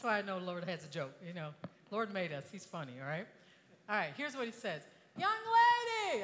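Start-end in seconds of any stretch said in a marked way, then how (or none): 5.2-6.2 long, drawn-out high holler; hailing shout. Retold or imitated. Yacht owner hailing a stranded woman, drawn-out shout. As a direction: call slowly across open water.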